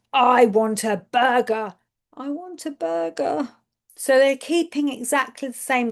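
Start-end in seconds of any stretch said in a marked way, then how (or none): 0.1-1.7 same words, new feeling in the voice; emotional intonation demo. Shown as a demonstration. Speech therapist demonstrating a changed intonation, emotional. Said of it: "with the appropriate emotional intonation". 2.1-3.5 higher, softer pleading version; emotional intonation demo. Shown as a demonstration. Therapist showing a child changing tone, higher and softer. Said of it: "with the appropriate emotional intonation".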